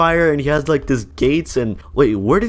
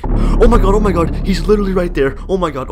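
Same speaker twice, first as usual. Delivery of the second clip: loud, high burst of panic; scared; 6 semitones higher, 5 dB louder than usual. Real, not acted. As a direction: panic at spotting someone right there. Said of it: "I'm scared".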